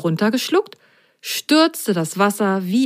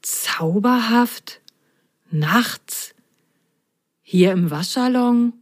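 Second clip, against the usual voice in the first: puzzled wonder; 3 semitones higher, slower than usual. Acted. Puzzled Popper echoes the claim.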